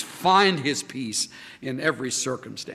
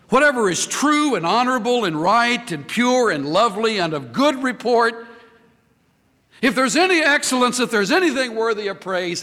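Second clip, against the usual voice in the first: raised, fervent reading of the verse; scripture reading; 6 semitones higher than usual. Acted. Preacher reads the verse aloud to his people, fervent. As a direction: proclaim the verse to the congregation.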